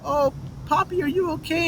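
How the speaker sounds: a lightly voiced caring woman; concerned lady. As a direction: coo it like a worried stranger. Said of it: "some lady comes up to me and she's like".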